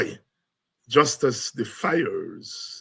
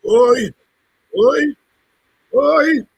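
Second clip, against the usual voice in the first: high, loud hype shouts; rally the crowd with loud calls; 11 semitones higher, 11 dB louder, slower than usual. Real, not acted. Host hyping the crowd.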